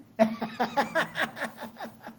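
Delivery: laugh. Real, not acted. Teacher laughs at own joke.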